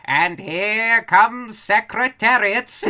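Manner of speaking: put-on loud, slow old-timey race announcer; 1940s announcer voice. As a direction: call the horse race like a 1940s radio man. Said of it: "whispers in this fucking nineteen forties announcer voice".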